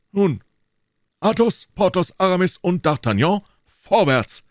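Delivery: high, emphatic rallying call. A narrator's reading. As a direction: summon each comrade by name, then charge. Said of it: "rief Athos".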